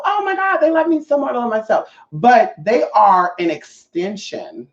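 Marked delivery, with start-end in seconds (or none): 0.0-1.9 much higher, quick gushing imitation; mock gushing. Mocking people who think others love them more, gushing. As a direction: lightly mimic someone gushing. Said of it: "you know".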